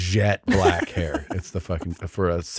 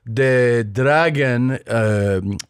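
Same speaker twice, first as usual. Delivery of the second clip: put-on Chinese accent; 3 semitones higher, 4 dB louder, much slower than usual. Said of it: "he did that whole Chinese accent".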